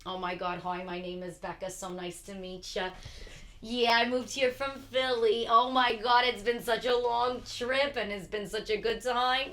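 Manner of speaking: hammed-up Long Island accent; a playful fake Long Island accent. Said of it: "use a fake Long Island accent".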